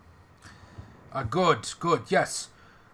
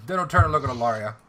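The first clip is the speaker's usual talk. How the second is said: usual speaking voice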